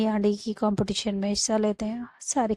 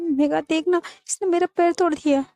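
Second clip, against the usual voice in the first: high-pitched, hurried tattling; mock-distressed accusation; whining complaint; 6 semitones higher than usual. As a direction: appeal urgently to a friend. Acted complaint to friend about injury.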